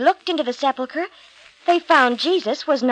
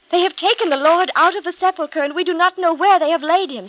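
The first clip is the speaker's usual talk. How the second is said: higher and louder, an urgent alarmed cry; crying out in distress; 6 semitones higher, 4 dB louder than usual. A narrator's reading. Mary to Peter and John, alarmed cry. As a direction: cry it out, desperate the body is gone. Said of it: "she cried".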